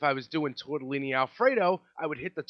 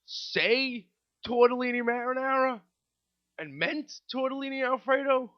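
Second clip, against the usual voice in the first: higher, sweetly fake-innocent question; 11 semitones higher than usual. Waiter blaming the customer, mock sweet innocence. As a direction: play dumb to pin the mistake on him. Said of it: "I'm like".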